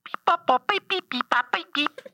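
high beeping like dialing a phone; high comic phone beeps; beeping phone noises. Shown as a demonstration. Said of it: "how I call the Instagram".

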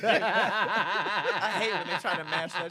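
laughing. Real, not acted. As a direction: laugh the words out. Laughing at mashup musicians.

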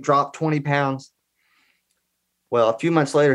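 ordinary talk

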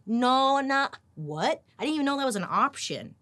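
loud, high, incredulous come on; outraged disbelief. Real, not acted. To the audience, exclaiming in disbelief at the rich, loud and high. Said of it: "outraged disbelief at the story".

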